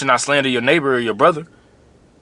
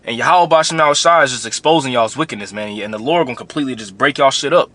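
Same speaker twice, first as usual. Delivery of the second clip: usual speaking voice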